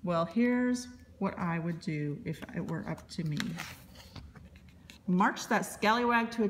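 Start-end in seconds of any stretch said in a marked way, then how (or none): none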